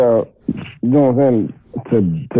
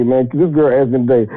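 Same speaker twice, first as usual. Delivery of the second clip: ordinary talk, nothing marked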